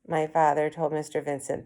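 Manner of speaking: usual speaking voice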